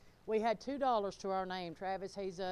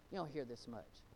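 low, soft, falling delivery; quiet remark; 8 semitones lower, 9 dB quieter than usual. Real, not acted. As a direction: say it low and under your voice. Repeats line in contrasting delivery.

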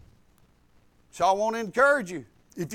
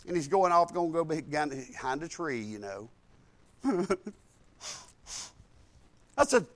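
usual speaking voice

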